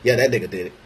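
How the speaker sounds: ordinary talk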